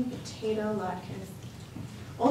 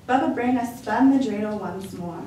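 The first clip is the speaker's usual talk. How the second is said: plain reading voice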